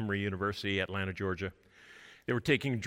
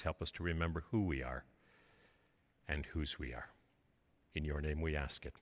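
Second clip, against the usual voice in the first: soft, fading prayer voice; 6 semitones lower, 3 dB quieter than usual. Acted. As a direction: pray quietly, winding down. Closing prayer.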